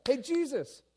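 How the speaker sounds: high, loud eager hail; calling out. Acted. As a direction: call to someone across the water. Peter calls across the water.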